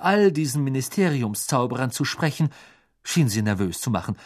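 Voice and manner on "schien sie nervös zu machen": plain reading voice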